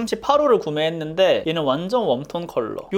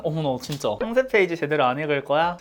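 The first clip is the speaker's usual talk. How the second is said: normal talking voice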